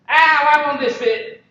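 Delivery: mock child whine; frustrated little girl. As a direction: play the daughter fed up with the puzzle. Voicing frustrated toddler daughter.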